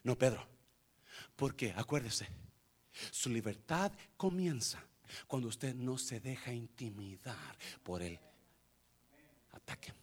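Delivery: usual speaking voice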